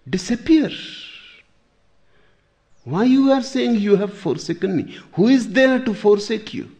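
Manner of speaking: loud, high-pitched challenge; acted scolding, voice pushed far up; challenging rebuke. Imagined sage rebukes Jesus' cry.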